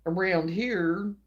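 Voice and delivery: usual speaking voice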